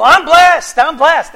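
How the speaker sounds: bright, loud double "I'm blessed"; cheerful reply. Retold or imitated. He answers the checkout clerk Susie: loud and cheerful. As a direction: beam it out to the cashier.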